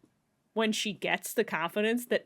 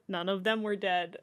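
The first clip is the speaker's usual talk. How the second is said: ordinary talk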